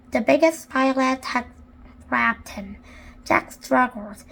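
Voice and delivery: ordinary delivery, nothing marked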